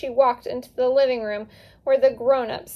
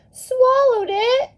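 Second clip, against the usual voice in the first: high, loud, slow cry of alarm; 13 semitones higher, 6 dB louder, slower than usual. A narrator's reading. Maria cries out as Danny admits swallowing it, high and alarmed. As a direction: gasp it out high, frightened for her cousin. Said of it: "Maria cried".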